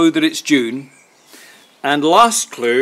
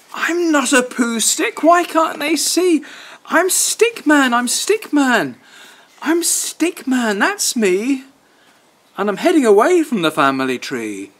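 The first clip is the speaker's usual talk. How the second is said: Stickman pleading; 10 semitones higher than usual. Acted. Stickman begging the dog to see him, high plaintive. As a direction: plead to be understood.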